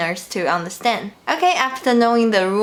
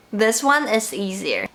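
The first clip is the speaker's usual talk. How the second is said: ordinary talk, nothing marked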